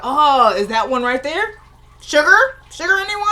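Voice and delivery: high, teasing sing-song taunt; mocking taunt. Villain taunting a wounded man.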